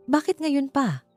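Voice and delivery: ordinary delivery, nothing marked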